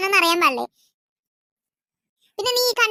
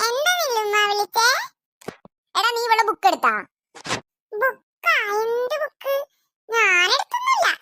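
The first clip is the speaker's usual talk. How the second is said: a lightly voiced small boy, higher, innocent; little boy voice; 5 semitones higher than usual.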